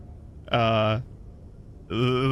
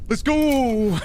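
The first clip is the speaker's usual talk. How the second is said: triumphant cheer; 10 semitones higher than usual. Real, not acted. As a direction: cheer the winning roll. Celebrates a lucky roll.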